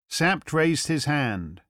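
ordinary delivery

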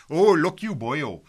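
loud, hammed-up Welsh accent. Shown as a demonstration. Host demonstrating his shaky Welsh accent to listeners, hammed-up Welsh. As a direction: put on a broad, unreliable Welsh accent. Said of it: "my Welsh accent is a bit unreliable".

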